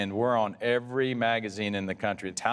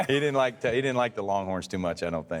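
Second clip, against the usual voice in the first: usual speaking voice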